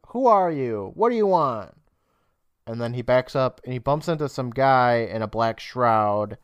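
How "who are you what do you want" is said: higher-pitched retold shout, falling at the ends; mild mock shout. Narrator imitates Billy in the graveyard, mock shouting. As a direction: call it out, half-shouted. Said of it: "before shouting".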